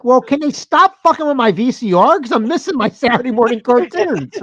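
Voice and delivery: a grown man sulking about missed cartoons; whiny complaint. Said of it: "I'm like".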